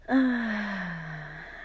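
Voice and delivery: long falling sigh settling into bed; contented sigh. Acted. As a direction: let out a long, audible sigh.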